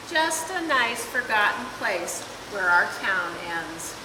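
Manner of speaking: ordinary delivery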